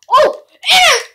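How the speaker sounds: very high, loud gasp-shout; startled shout. Real, not acted. Player seeing a pass almost intercepted, startled shout. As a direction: yelp at the near pick.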